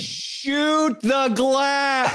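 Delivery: drawn-out, very slow words; hammed-up slow English for a foreigner; slow mock English. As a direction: stretch each word out as if to a foreigner. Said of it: "when he said it slow in English".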